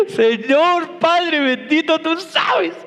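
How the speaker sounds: high, hammed-up weepy plea; mock tearful prayer. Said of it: "llorando le dije". (rezo lloroso exagerado)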